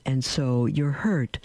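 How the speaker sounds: ordinary talk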